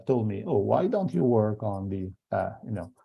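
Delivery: ordinary delivery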